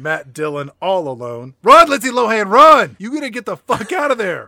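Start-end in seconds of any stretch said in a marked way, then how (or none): 1.6-4.5 loud high yell of warning; mock yell. Podcast host shouting at Lindsay Lohan on screen, loud high yell. Yelling warning at movie character.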